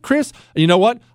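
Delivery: normal talking voice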